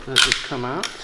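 ordinary delivery

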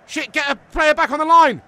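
high, loud alarmed yell; panicked shout. Real, not acted. Streamer scrambling his defence back, panicked shout. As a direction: bark the order in a panic.

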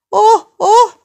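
very high, loud jeering "oh"; gloating taunt. Acted. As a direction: crow at her from a safe distance. Çocuk uzaktan nispet yapıyor. (uzaktan yüksek sesle nispet yaparak)

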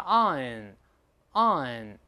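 slow drawn-out "on" between on and an; vowel demo. Shown as a demonstration. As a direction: stretch "on" slowly to show the vowel. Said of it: "你看on，on". (慢慢示范on的元音)

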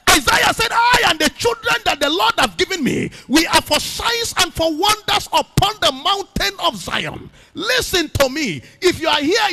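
loud, high, ringing sermon cry; preaching at full force. Acted. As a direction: preach it out to the whole church, full power. Preaching to the congregation.

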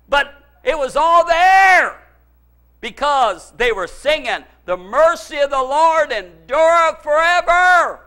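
loud, high, long-held words; preaching at full force. Real, not acted. Preacher crediting victory to their singing, full-force preaching. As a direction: proclaim it triumphantly, stretching the words.

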